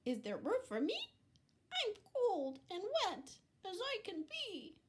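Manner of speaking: very high, fast little pig; high piglet voice. Acted. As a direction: squeal it like a cold wet piglet. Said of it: "said Pig".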